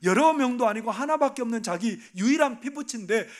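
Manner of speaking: high, loud impassioned sermon; full-force preaching. Real, not acted. Preacher pleading with the congregation, loud. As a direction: drive it home from the pulpit.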